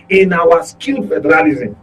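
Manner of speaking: normal talking voice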